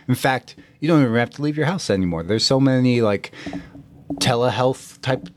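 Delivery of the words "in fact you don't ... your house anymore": usual speaking voice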